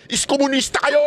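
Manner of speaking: high, jeering shouted insult; mock insult yell. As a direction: parody the critics cursing. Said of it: "minura niyo kami lahat".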